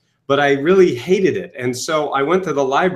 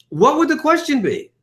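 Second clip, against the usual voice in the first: wondering question; 11 semitones higher than usual. Retold or imitated. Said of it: "I said".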